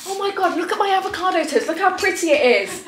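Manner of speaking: high excited gushing over food. Pretend. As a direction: show off the toast, thrilled. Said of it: "say I was here and be like".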